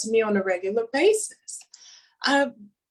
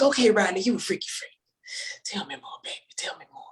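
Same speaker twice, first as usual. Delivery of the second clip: whispering; faster than usual. Retold or imitated. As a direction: coax him on in a hush. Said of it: "I'm like".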